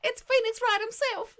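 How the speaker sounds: high, loud joke voice; hammed-up grand introduction; put-on announcement. Streamer presenting Phoenix Wright to Rythian, silly and loud.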